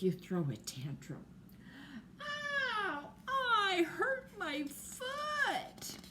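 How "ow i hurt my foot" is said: much higher, slow crying whimper; mock crying child. Girl hurt her foot kicking things, high crying whimper. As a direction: whimper it like a kid who stubbed her foot.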